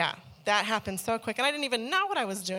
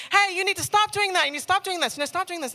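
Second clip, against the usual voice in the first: high, loud, insistent lecturing; preachy brother; 10 semitones higher, 7 dB louder, faster than usual. Retold or imitated. Brother lecturing his sinful sister, preachy. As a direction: hammer the repeated stop like a new convert. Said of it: "run home and tell his baby sister".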